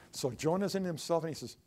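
ordinary talk, nothing marked

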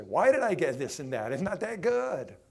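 mildly hammed-up griping; mock complaint. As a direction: act out someone let down by what they got. Acted complaint of the dissatisfied.

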